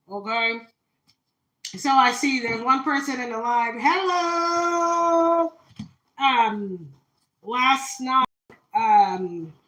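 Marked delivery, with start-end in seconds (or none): 3.8-5.5 high and loud drawn-out hello; excited hello. Real, not acted. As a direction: sing out a big welcome. Greeting a live viewer.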